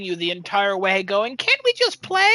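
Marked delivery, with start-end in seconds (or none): 1.4-2.3 very high, pleading little toy voice; pleading elephant. Retold or imitated. The stuffed elephant begging the parents, high pleading toy voice. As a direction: beg to play like a small stuffed toy.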